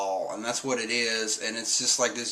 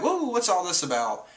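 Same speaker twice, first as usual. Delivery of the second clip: higher, wide-eyed wonder; mock amazed crew; 7 semitones higher than usual. Crew gawking at the explanation, higher wonder. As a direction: marvel at it all. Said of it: "they're all like".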